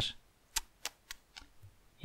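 soft tongue clicks of disapproval; a teasing tut-tut; unvoiced tutting. A narrator's reading. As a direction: tsk tsk tsk, mocking. Peeves tutting.

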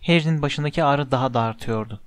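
usual speaking voice

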